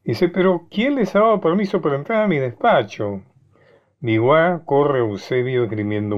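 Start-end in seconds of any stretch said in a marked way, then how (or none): 0.3-3.2 higher, feigned indignant scolding; a put-on annoyed rebuke; mock displeasure. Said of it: "finge disgusto". (fingiendo disgusto, más agudo)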